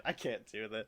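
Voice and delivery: ordinary delivery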